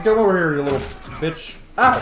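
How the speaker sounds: normal talking voice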